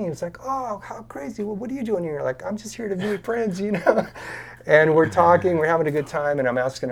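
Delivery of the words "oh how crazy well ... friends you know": retold exchange in a high, lightly surprised voice; mock amazement. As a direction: pitch it well up, as if delightedly recognising someone. Retold happy recognition between two acquaintances.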